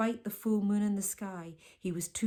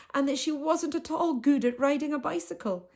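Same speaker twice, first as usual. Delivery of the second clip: ordinary delivery, nothing marked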